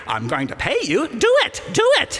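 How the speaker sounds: building from a put-on voice into a very high, loud squeal. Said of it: "that voice".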